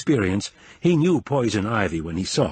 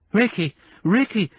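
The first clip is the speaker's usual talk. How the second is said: high, raised cries of a name; panicked scream; 10 semitones higher than usual. Acted. Bitten woman screaming for her husband, high panicked calls. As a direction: call for help in panic, pitch way up. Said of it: "she screamed in panic".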